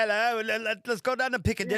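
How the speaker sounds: high, quick hammed-up British; fake British accent. Host showing off for the English guest, bad fake British accent. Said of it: "affecting a fake British accent".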